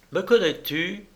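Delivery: high-pitched challenging question; confronting question. A narrator's reading. Veiled woman accosting the baron in the street, challenging.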